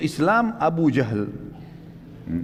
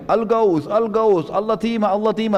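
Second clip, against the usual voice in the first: higher, raised alarm calls; retold cry of alarm; 5 semitones higher than usual. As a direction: shout the alarm before the Quraysh leaders. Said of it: "Teriak-teriak lagi". (teriakan tanda bahaya yang diperankan)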